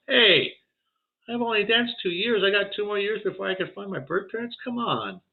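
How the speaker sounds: high pleading voice. Retold or imitated. Pleading with the teasing spirits, high and whiny. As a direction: whine it up high like a kid pleading.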